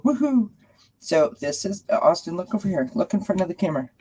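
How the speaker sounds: pet talk. Real, not acted. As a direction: sweet-talk the cat to come up. Calling cat to camera.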